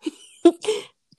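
giggling through the words; can't stop laughing. Real, not acted. Giggling, fails to pronounce word.

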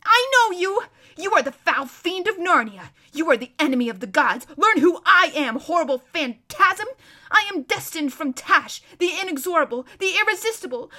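loud, high ranting tirade; frenzied cursing. Acted. Prince cursing the lion as a fiend, ranting. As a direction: hurl curses without letting up.

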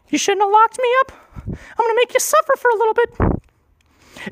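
vengeful brain. Retold or imitated. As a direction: threaten him from up high, playfully. Brain threatens him.

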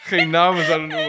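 shouting through laughter; laughing shout. Real, not acted. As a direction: burst out laughing loudly. Laughing while finishing sentence.

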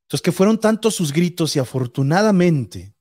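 normal talking voice

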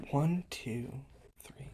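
very soft, fading into a whisper; barely audible tallying; counting to himself. Real, not acted. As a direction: count under your breath. Counting bullet points under his breath.

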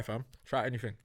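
normal talking voice

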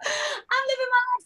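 high, sassy and self-assured, dragging out the honeys; sassy diva. Retold or imitated. Singer answering Simon's harsh comments, sassy and high. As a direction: toss it off like a star who won't be told. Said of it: "I was like".

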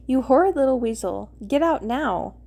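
plain reading voice

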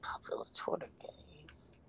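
soft unvoiced echo; whispering. Real, not acted. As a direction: whisper it back to yourself. Whispered echo of friend's words.

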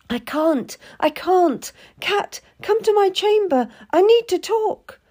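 put-on high, pleading girl's voice; a naive girl begging her friend; distressed young lady. A narrator's reading. Frightened lady begs Cat to come, high pleading.

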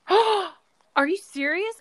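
gasping, stunned disbelief; real shock, slow and loud. Real, not acted. Shocked reaction to director's arrest.

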